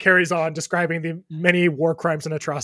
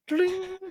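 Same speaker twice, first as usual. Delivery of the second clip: mouthed dling. Acted. Mouth-made lute strum.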